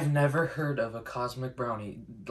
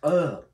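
disgusted ugh; 2 semitones higher, 8 dB louder than usual. Real, not acted. Disgusted reaction to taste.

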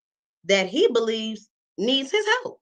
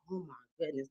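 much softer, under the breath; exasperated mutter; 3 semitones lower, 16 dB quieter, faster than usual. Real, not acted. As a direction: sigh it out under your breath.

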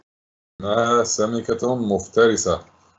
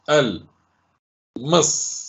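isolated syllables with pauses between; much slower than usual. Shown as a demonstration. Teacher splitting a word for pupils, syllable by syllable. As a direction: sound out each syllable separately. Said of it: "ننطقها صوتًا صوتًا".

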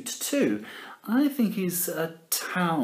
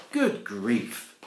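plain reading voice

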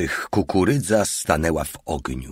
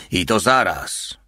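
ordinary delivery, nothing marked

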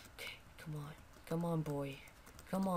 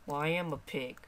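normal talking voice

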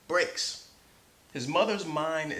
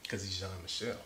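ordinary delivery

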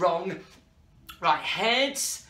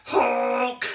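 a goofy honk for the nose; showing the kids a funny nose noise; 3 semitones higher than usual. Shown as a demonstration. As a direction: honk like a silly clown nose. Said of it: "gonna make a really loud noise".

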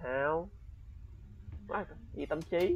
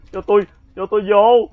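panicked plea; 8 semitones higher, 13 dB louder than usual. Real, not acted. Panicked while being chased.

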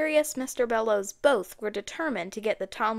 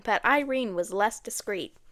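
ordinary delivery, nothing marked

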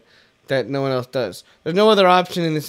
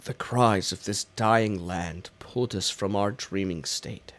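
solemn lore reading; 4 semitones lower than usual. Acted. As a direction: intone it like an ancient ghost's record. Reads the lore aloud.

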